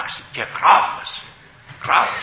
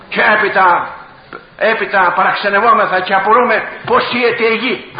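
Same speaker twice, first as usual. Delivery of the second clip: raised, high-pitched pulpit outcry; fiery preaching; 7 semitones higher, 7 dB louder than usual. Real, not acted. Preacher denounces what the sewers held, fiery preaching. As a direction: preach it loud and indignant.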